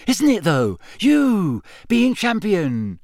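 put-on high-pitched, awestruck boy; excited. A narrator's reading. Young fan marvelling at champion Harry, excited. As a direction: gush in a high schoolboy voice.

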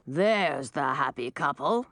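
a hint of a regal dragon; ice dragon. A narrator's reading. Ice Dragon King greets couple.

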